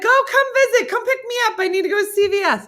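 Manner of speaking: high, loud, whiny needy voice; imitating Pat. Mimicking a friend who needs rides, in a high loud whine. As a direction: beg for a ride in a high whine. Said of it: "She'll be like".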